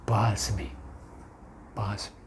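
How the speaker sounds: awed, breathless whisper. Real, not acted. Awed aside to the congregation.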